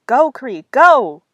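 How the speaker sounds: loud, high urgent shout; exclaiming. A narrator's reading. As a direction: shoo her out the door with a yell. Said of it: "she exclaimed".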